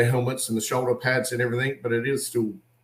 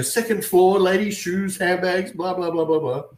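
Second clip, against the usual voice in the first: high-pitched department store floor announcement; mum's lift-lady patter, pitched up; 7 semitones higher, 4 dB louder than usual. Retold or imitated. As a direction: call out the floors like a lift operator. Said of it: "she'd be the lift lady".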